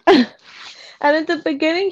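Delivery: usual speaking voice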